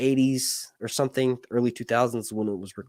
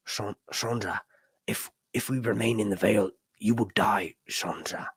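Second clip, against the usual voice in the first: low, soft, half-whispered menace; quiet, lowered character voice; Littlefinger impression; 3 semitones lower, 4 dB quieter than usual. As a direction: drop low and hush into a whisper. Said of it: "the actor who does that".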